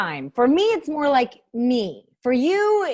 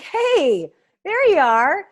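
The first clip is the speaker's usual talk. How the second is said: mock surprise; 7 semitones higher, 5 dB louder, much slower than usual. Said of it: "they're probably like".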